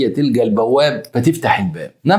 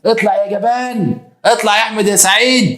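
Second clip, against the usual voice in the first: wronged wife calling out; 10 semitones higher than usual. Retold or imitated. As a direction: stretch the call, pitched high and indignant. Acted wife calling coward out.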